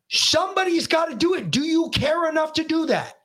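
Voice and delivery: high-pitched, emphatic retold sermon to a child; yelling tirade. Dad tells son to carry on, yelling tirade. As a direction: re-enact a heated rant, hammering each phrase. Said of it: "after he was done yelling".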